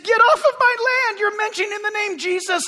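high furious shouting, retold; angry shouting. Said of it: "came to me and said".

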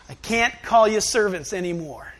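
ordinary delivery

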